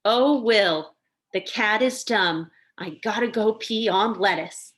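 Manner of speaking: loud, deliberate kid's fake Irish accent; little boy reading out in mock-Irish accent. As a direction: sound out English words as pretend Irish. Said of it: "just English with an accent".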